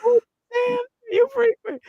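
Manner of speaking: startled yelp. Real, not acted. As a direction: jump out of your skin. Startled by something.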